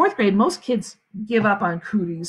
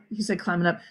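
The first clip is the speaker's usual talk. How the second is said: plain reading voice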